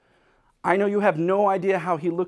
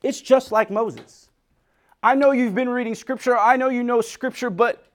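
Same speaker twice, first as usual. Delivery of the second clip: louder rising sermon, pressing the congregation; full-force preaching; 6 semitones higher, 6 dB louder than usual. Acted. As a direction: preach it hard to the room.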